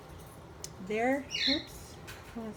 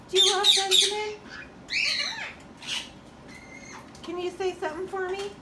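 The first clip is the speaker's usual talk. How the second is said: high coaxing pet voice; 6 semitones higher than usual. Real, not acted. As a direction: coax the bird to speak. Coaxing bird to talk.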